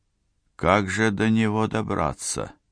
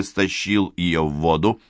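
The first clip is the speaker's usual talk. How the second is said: usual speaking voice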